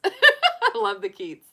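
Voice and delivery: slow, high and laughing. Real, not acted. Joking with chat about parakeets, slow and giggling. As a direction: giggle the silly pun out slowly. Laughs at her own pun.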